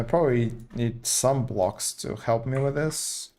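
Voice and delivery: usual speaking voice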